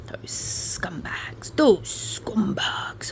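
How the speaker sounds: slow hissed whisper; a slow contemptuous whisper. Real, not acted. Whispered insult.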